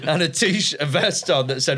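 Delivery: ordinary talk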